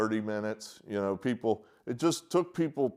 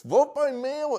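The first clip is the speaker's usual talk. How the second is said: "Vote by mail" slow, much higher and louder; exasperated shout; 12 semitones higher, 5 dB louder than usual. Real, not acted. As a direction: plead loudly with exasperation. Repeats plea.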